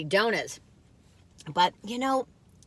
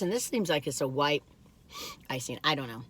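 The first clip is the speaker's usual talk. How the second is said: ordinary talk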